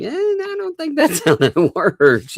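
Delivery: high-pitched, laughing doubt; mock scepticism. Acting out a sceptical reaction.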